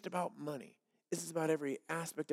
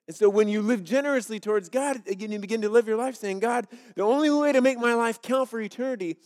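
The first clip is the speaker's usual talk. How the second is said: louder, driving preacher voice; forceful preaching; 6 semitones higher, 7 dB louder than usual. Acted. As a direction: press each line home to the congregation.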